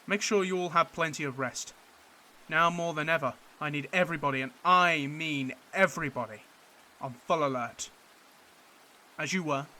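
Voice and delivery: loud, firm officer addressing his men; rally the troops with command; commanding officer briefing. Acted. Officer briefing his men before the bombardment, loud and firm.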